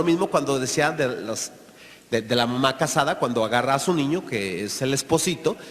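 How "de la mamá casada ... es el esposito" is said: usual speaking voice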